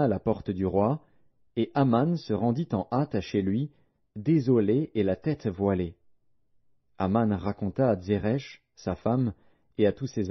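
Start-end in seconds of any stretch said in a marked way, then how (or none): none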